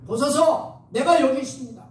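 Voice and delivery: high, projected declaration; acted solemn response. Preacher as Moses answering God's call, high declaring voice.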